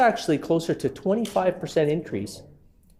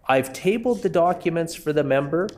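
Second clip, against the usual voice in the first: ordinary talk, nothing marked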